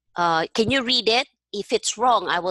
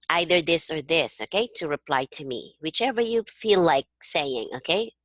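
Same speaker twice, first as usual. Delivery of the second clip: ordinary talk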